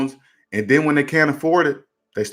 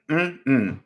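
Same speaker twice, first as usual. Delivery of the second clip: usual speaking voice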